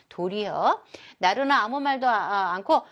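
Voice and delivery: ordinary delivery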